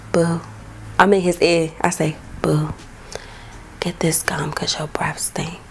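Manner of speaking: breathy whisper right in his ear; soft secret whisper to boyfriend. Retold or imitated. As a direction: lean in and whisper it privately. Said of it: "that's how I say it in his ear".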